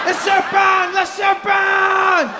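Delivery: put-on very high, stretched French-accented cries; panicking Frenchman. French dad fleeing the snake, high mock-panicked shouting. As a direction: shriek alarm like a hammed-up French tourist.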